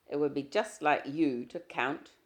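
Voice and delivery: usual speaking voice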